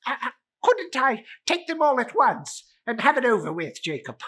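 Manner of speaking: high, loud, fast stammer; nervous bargaining. Acted. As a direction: hurry the plea out in fright. Scrooge bargains with ghost.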